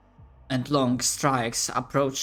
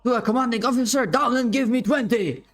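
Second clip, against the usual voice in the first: high, fast barked order; drill colonel; 7 semitones higher, 4 dB louder, faster than usual. Acted. Colonel ordering a soldier down for push-ups, fast barked order. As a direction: bark out the punishment.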